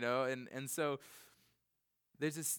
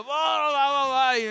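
much higher, loud pretend shriek of gibberish; screaming nonsense; 11 semitones higher, 15 dB louder than usual. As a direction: disrupt the sermon with a wild yell. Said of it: "just start screaming out".

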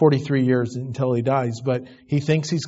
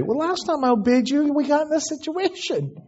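sassy retort; 11 semitones higher than usual. Pretend. Son sassing his mother's order.

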